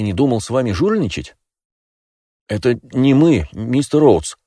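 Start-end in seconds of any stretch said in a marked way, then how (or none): 2.5-4.4 pleading. Acted. Pleading with the kidnapper.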